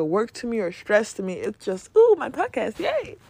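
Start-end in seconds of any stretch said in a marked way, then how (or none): 2.0-3.2 high little squeal of glee; light acted cheer; playful delight. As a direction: pipe up excitedly, ending on a high yay. Acts out delight at own podcast.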